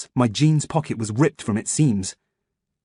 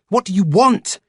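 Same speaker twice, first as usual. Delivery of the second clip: higher, louder scream; desperate screamed demand; 9 semitones higher, 5 dB louder than usual. A narrator's reading. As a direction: scream it at him. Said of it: "I screamed".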